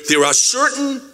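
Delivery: normal talking voice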